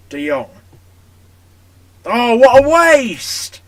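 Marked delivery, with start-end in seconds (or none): 2.1-3.6 drawn-out, loud, higher cry of despair; anguished shout. Real, not acted. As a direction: stretch it out like a gutted commentator. Missed chance, commentator-style cry.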